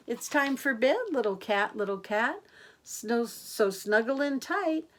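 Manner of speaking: sing-song rhyming read, lifting on each line; bedtime rhyme. A narrator's reading. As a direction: lilt the rhyme to little ones. Reads bedtime rhyme to children.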